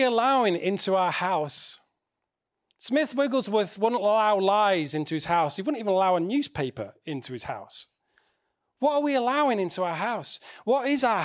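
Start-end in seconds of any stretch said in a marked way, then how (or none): none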